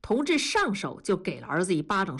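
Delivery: plain reading voice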